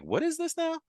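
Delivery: high-pitched mock bafflement; puzzled surprise. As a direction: squeak it out as an acted double take. Acting out surprised reaction to discovery.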